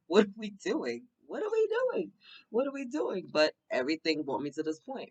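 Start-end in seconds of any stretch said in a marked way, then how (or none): 0.1-3.3 high, incredulous repeated question, climbing to a squeal; mock disbelief. Former porn performer now a pastor, squealing in mock disbelief. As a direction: pitch it way up in amused bafflement.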